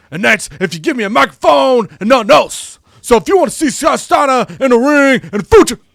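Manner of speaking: over-the-top shouted wrestler promo; Scott Steiner promo. Retold or imitated. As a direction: yell it like an unhinged heel on the mic. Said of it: "Scott Steiner comes out and says".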